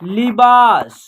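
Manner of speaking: लिबास with a long, stressed बा; pronunciation demo. Shown as a demonstration. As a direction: sound out लिबास, stretching the second syllable. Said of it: "क्या हो जाएगा?". (लिबास में बा खींचकर उच्चारण)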